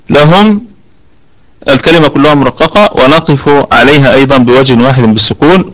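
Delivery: ordinary talk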